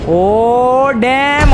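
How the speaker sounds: long drawn-out, high exclamation; high-pitched, slow astonished cry; amazed cry. Real, not acted. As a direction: stretch it out in startled awe. Startled trying to fly.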